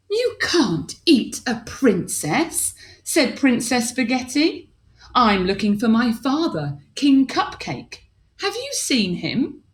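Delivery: a princess standing her ground. Acted.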